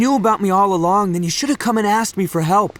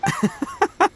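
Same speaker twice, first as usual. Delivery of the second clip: hearty laugh; 3 dB quieter than usual. Acted. Itto laughs off the remark.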